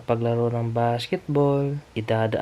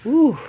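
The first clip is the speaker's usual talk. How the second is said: high, sudden woo; short rising-and-falling whoop; venting whoop; 7 dB louder than usual. Real, not acted. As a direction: let out a high woo of overwhelm. Venting before sharing stress.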